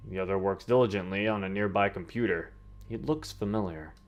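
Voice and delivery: normal talking voice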